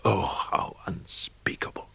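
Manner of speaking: breathy whisper of dread; a horrified whisper; hushed horror. A narrator's reading. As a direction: whisper it as if it can't be spoken aloud. Horrified exclamation in the dark.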